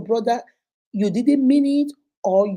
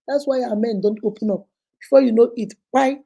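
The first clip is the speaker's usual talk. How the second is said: ordinary delivery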